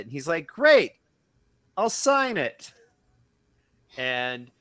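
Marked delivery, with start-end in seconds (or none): 0.5-2.7 much higher, loud mock holler; retold eager shout. Comedian answers his new fan, hammed-up eager shout. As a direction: imitate jumping eagerly at the chance.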